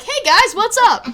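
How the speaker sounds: high, loud cheery mock greeting; chirpy greeting. Imitating Rhonda's sudden cheery arrival, high mock voice. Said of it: "Rhonda's like".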